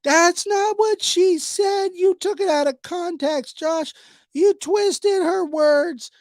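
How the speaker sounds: high, slow whiny mock voice of the commenters; mocking critics. Vlogger mocking his commenters in a high whiny voice. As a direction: sneer it like an outraged commenter. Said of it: "people have been emailing me, commenting".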